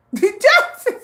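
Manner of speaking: high burst of real laughter. Real, not acted. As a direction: laugh out loud at the comment. Laughs at chat comment.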